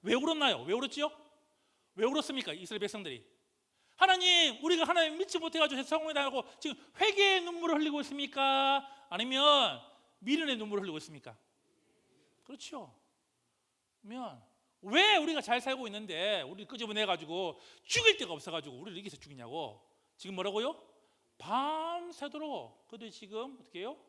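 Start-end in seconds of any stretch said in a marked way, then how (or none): 4.0-6.2 loud, high, pleading cry to God; repentant cry. Retold or imitated. Preacher voicing Israelites crying out in repentance, high pleading cry. 14.8-19.8 loud grumbling protest, dropping at the end; complaining. Retold or imitated. Preacher quoting Israelites grumbling, loud aggrieved protest.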